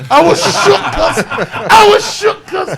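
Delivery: loud, laughing, high and slow; laughing shout. Real, not acted. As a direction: crack up while shouting it out. Laughing at his own fear.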